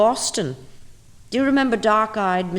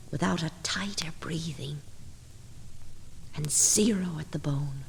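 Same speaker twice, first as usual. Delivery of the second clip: hushed whisper; 2 semitones lower, 7 dB quieter than usual. Acted. Eerie poem, unvoiced reading.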